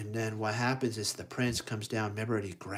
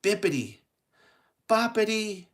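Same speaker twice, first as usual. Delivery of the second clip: loud, high magic chant, building; spaced-out spell rising to a long cry; fairy godmother; 10 semitones higher, 8 dB louder, slower than usual. Acted. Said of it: "fairy godmother would say".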